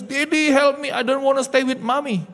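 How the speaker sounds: put-on high, pleading child's voice; crying child. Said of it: "Dia nangis". (meniru anak menangis memohon kepada ayahnya)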